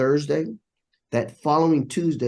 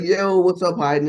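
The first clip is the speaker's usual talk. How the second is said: higher, louder mock hail; teasing greeting; 6 semitones higher, 4 dB louder, slower than usual. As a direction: call out a playful hello to a buddy. Session crowd greets him teasingly.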